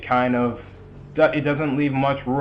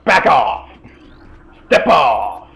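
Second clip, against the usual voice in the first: sharp loud shouts at an enemy; yelling; 7 dB louder, much slower than usual. Acted. Player shouting at the ambushing space pirate, loud yell. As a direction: bark it out to drive him back.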